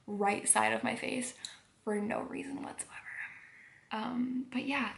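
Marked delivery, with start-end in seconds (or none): 1.8-3.0 softer whispered aside. Real, not acted. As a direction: whisper it dryly.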